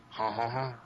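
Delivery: hint of a mock French laugh; lightly put-on French chuckle; playful French-accented "ha, ha, ha". Shown as a demonstration. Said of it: "my mysterious French accent".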